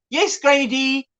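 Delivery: ordinary delivery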